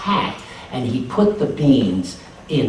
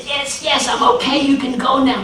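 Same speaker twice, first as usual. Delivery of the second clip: strained high voice; 12 semitones higher, 4 dB louder than usual. A narrator's reading. Anansi in pain, hiding it.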